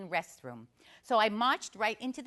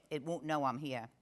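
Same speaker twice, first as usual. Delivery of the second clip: normal talking voice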